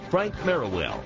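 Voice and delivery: normal talking voice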